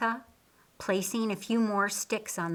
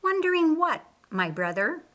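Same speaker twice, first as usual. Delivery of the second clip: playful; 8 semitones higher, 3 dB louder than usual. Acted. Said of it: "she playfully asked".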